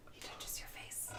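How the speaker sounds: breathy whispered aside; hushed whisper. Acted. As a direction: whisper it like a secret stage direction.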